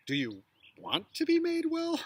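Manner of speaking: "be" pitched very high and held long; stressed be. Shown as a demonstration. Teacher demonstrating a strongly stressed "be" on the line. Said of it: "you could emphasize any one of those words".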